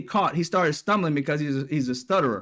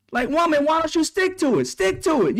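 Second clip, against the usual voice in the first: higher mock yell at the screen; 9 semitones higher than usual. Viewer yelling at the TV anchor, mock yell. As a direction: shout at the anchor like a frustrated fan. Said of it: "screaming at the fucking T V".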